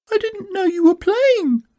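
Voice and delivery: very high-pitched squeaky little Roo. A narrator's reading. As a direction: speak as surprised tiny Roo, way up high. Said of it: "said Roo".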